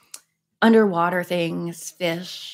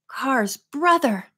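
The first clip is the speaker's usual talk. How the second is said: higher, slow exclamation; raised pitch, slowly dawning surprise; exclaiming; 6 semitones higher, slower than usual. A narrator's reading. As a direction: draw out the startled realization. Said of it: "Nancy exclaimed".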